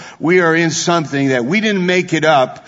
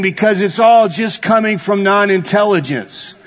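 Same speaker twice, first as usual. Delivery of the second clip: high, loud fervent preaching; preaching at full force; 7 semitones higher, 3 dB louder than usual. Acted. Preacher scolding the evolutionist, full-force preaching. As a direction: hammer the point home.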